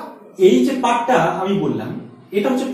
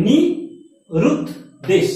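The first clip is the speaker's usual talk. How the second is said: নি, রুদ, দেশ with pauses between; syllable by syllable; 5 semitones higher than usual. Shown as a demonstration. Teacher demonstrating the মাত্রাবৃত্ত count, paused. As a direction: break the word into spaced syllables. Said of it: "ভেবে দেখো".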